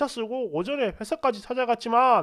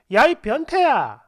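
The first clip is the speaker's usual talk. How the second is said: loud, drawn-out indignant outburst; mock accusation; 3 semitones higher, 7 dB louder, slower than usual. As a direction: call him out in exaggerated outrage. Girlfriend's sudden accusation on phone. (과장되게 큰 소리로 따지듯)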